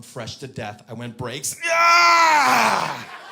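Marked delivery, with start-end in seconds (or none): 1.7-3.2 long, high, very loud yell; triumphant scream. Acted. Comic celebrating saved coffee, triumphant scream. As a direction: let out a huge drawn-out victory scream.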